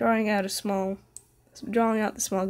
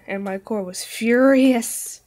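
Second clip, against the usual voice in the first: hammed-up fury; 8 dB louder, slower than usual. Dramatic reading of fury.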